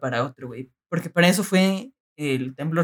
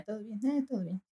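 very soft, tossed-off murmur; offhand reassurance; 4 semitones higher, 14 dB quieter than usual. Acted. Student phoning parents after the quake, quiet offhand reassurance. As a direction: drop right down, barely voiced. Retold phone call to parents.

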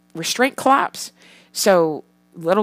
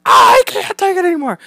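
high, loud hammed-up outburst; mock exasperated yell; 13 semitones higher, 9 dB louder than usual. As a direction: yell back like a parent at breaking point. Acted yell back at daughter.

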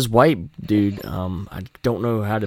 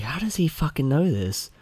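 "How does he fucking know this?" asked higher, in a put-on astonished voice; stunned onlooker; 6 semitones higher, 3 dB quieter than usual. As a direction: play a baffled local caught off guard. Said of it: "they'll look at him funny".

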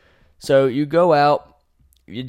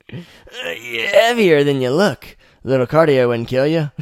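lightly voiced Traeger; 4 semitones higher, 6 dB louder than usual. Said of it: "you meet Traeger".

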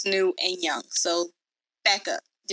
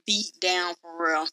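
normal talking voice

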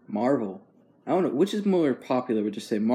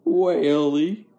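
put-on old grandpa; 4 semitones higher, 6 dB louder than usual. Shown as a demonstration. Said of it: "You sound like my grandpa".

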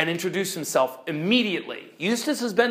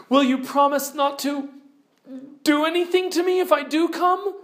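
much higher, anxious pleading; frightened girl Jill; 9 semitones higher than usual. Acted. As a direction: beg for safety nervously. Jill fearfully bargains.

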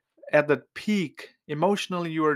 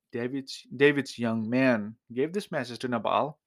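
ordinary talk, nothing marked

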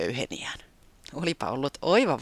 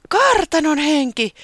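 "kartanon henki" high, loud and slow in awe; delighted; 11 dB louder, slower than usual. A narrator's reading. Taputi thrilled at the ghost, saying "kartanon henki" high and loud. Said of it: "se riemastui".

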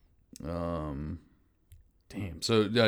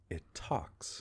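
hushed; 11 dB quieter than usual. Acted. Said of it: "Tennis just goes".

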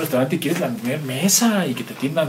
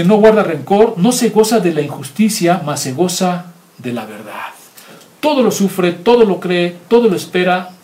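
louder, ringing declaration of each verse; preaching scripture; 3 semitones higher, 4 dB louder than usual. Acted. Preacher proclaims Corinthians verses to the congregation, ringing and emphatic. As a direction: proclaim each TODO like a sermon.

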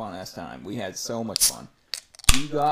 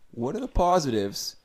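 ordinary talk, nothing marked

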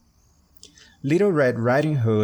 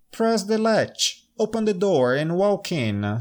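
grandmother voice; 8 semitones higher than usual. Acted. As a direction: call out like a frail grandmother. Grandmother answering from bed.

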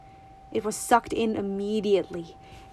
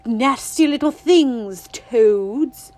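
disgust; 3 semitones higher, 8 dB louder, much slower than usual. A narrator's reading. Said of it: "wrinkling her nose in disgust".

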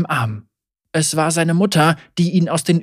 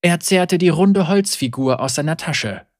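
ordinary delivery

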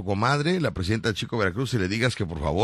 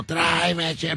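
mock yell; 5 semitones higher, much slower than usual. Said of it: "pero así grita".

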